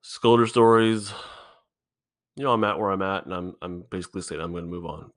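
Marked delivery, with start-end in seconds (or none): 3.2-5.1 real whisper, much softer; whispered admission. Real, not acted. Confessing to his listeners he'll quit the podcast, a whisper. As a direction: let it slip out in a hushed whisper.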